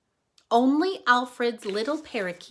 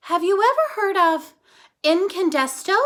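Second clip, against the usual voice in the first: higher and louder, bursting with it; eager question; 6 semitones higher, 8 dB louder than usual. Acted. Flora to Missus Tickum, eagerly pitching her superhero idea. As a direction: ask it like a big reveal.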